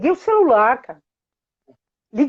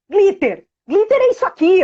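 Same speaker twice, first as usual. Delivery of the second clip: put-on insistent child; 9 semitones higher, 4 dB louder than usual. Daughter voiced in story.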